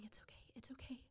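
whispered, hushed comfort; soft reassurance. Retold or imitated. Birth mom comforting the caller, whispered reassurance. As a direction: whisper it's okay to soothe her. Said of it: "And she was going".

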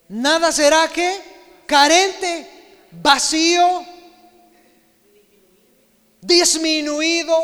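rising sermon fervor; fervent preaching. Real, not acted. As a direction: drive each word home to the congregation. Preaching at full force.

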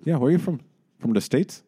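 high, put-on Dublin accent. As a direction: quiz him as a chatty Dubliner. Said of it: "your Dublin accent".

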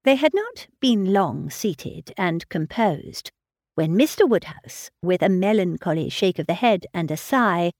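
plain reading voice

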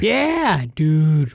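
excited outburst, pitch leaping on the first word; sudden excitement. Real, not acted. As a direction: blurt it out, overexcited. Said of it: "Why did I get so excited".

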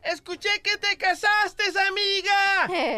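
put-on high-pitched, drawn-out call to a girlfriend; sing-song holler between car windows, hammed up; mock calling out. Grita desde otro carro, semáforo. (llamado agudo y alargado de amiga, exagerado)

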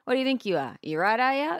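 a hint of a gruff Aussie dad, rising at the end; dad's Aussie drawl. Imitating her old-school Aussie dad teasing her dressed-up look. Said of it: "That's how he talks".

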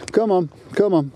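high two-part call to a dog. Real, not acted. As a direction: summon the dog in a high lilt. Calling the dog back.

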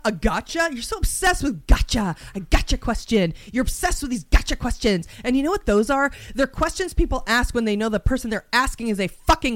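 indignant, escalating rant; raging out. Pretend. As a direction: tear into them, swearing emphatically. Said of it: "raging out in my mind".

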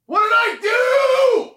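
very high, slow, drawn-out cry; a kid shouting from his room after being punished; anguished shout. Retold or imitated. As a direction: wail it from the bedroom, hurt and confused. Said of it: "And I remember going".

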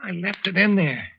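rising alarmed confession; the gun's gone and he knows it; panicked. Acted. Panic over lost gun.

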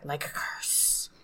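slow, soft ominous whisper. Acted. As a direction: breathe it like a curse. Ominous aside about a curse.

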